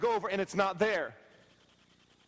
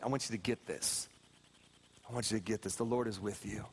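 hushed preaching; 10 semitones lower, 4 dB quieter than usual. Acted. Pastor softly assures the congregation, hushed and low. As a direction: lean in and murmur it to each soul.

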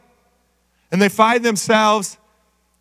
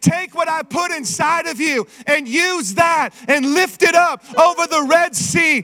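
full-force preaching; 5 semitones higher than usual. Acted. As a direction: preach it hard to the church.

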